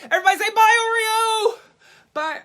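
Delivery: put-on rally the kids to wave goodbye; cheerful call. Host leading the audience in saying bye to Oreo, loud excited call.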